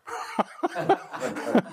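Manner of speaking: soft airy laugh rising up; breathless giggle at the punchline; breathy rising laugh. Real, not acted. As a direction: let a quiet laugh float up. Laughing at own joke.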